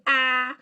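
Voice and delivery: "ah" as the short A in cat; short A sound. Shown as a demonstration. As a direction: say "ah" as an isolated short-A vowel. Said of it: "Those are the short vowel sounds".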